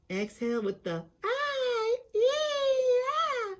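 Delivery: very high, slow breathy ah; airy high vocalise. Shown as a demonstration. Voice lesson, high exhaled vowel. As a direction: breathe out into a high floating note. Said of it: "step-by-step instruction".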